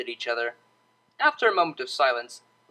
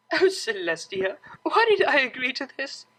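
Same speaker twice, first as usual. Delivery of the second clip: higher, mock despair through giggles; exasperated laugh; 10 semitones higher than usual. Roommate laughing at her own choice, mock exasperated. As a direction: groan at the deal, still laughing.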